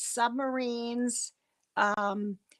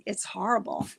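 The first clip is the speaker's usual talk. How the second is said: ordinary talk, nothing marked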